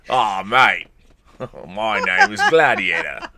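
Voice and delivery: slow, exaggerated Australian accent with a laugh; drawn-out joke Australian accent, amused; mock Australian accent. Host hamming up the Gladiator line for a viewer, mock Australian accent. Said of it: "using your fantastic Australian accent".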